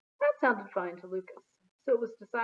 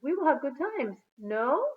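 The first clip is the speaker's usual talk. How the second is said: louder, higher French visitor asking No at the end; cheerful French girl, "No?" tacked on as a tag; lightly voiced French accent; 5 semitones higher, 6 dB louder, slower than usual. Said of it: "Genevieve also said funny things".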